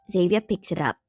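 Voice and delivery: ordinary delivery, nothing marked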